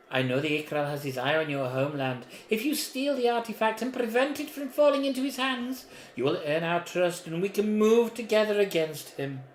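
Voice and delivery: mysterious voice. Acted. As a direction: read it as the hidden patron. Reads the mysterious voice aloud.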